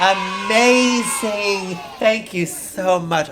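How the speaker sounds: high, slow, drawn-out amazement; gushing host. Acted. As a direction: stretch out the praise like an overawed host.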